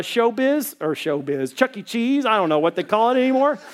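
ordinary delivery

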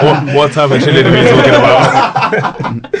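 slow, laughing through the question; laughing tease. Real, not acted. Friend teasing host about what chill means, laughing. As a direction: crack up while asking.

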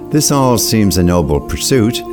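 usual speaking voice

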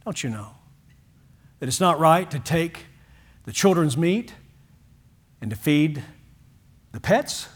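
tender teasing question; gentle smile. Retold or imitated. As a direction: say it kindly with a smile. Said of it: "he said it with a smile".

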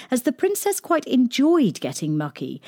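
ordinary delivery, nothing marked

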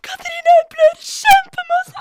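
high, loud, slow call for help; yelling for Katrine, hammed-up alarm; mock panicked shout. Calling friend while bleeding.

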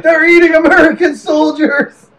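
high, loud panicked cry. Pretend. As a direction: cry out in alarm. Panicked public voiced.

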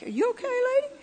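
high, concerned voice of the usher. Retold or imitated. Usher asking a sobbing woman if she's okay, high and concerned. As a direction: play the worried usher checking on her. Usher handing tissues to her.

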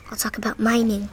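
soft unvoiced whisper. Real, not acted. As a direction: whisper it to the listener. Whispered aside.

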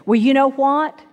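usual speaking voice

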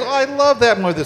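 gushing fan cop. Retold or imitated.

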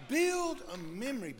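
rising shout on build, pitched high; exhortation. Acted. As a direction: call it out like a charge to the room. Preacher exhorting the congregation.

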